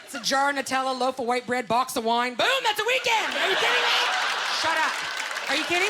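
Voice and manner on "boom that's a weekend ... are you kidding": very high, loud squeal of excitement; over-excited outburst. Acted. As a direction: blurt it out high and thrilled. Excited punchline about Nutella weekend.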